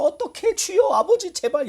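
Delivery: high, desperate begging; frantic pleading. Retold or imitated. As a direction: cry out to God in panic as the clock runs down. Panicking fans pleading.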